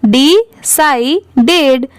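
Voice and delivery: decided slowly, syllable by syllable; decided split up. Shown as a demonstration. Teacher showing how to read it, decided split up. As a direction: break decided into dee, sai, ded. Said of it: "ऐसे आप पढ़ सकते हैं".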